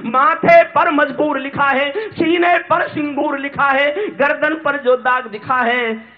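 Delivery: high, forceful declamation; impassioned verse. Acted. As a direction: pound out the rhythm line by line.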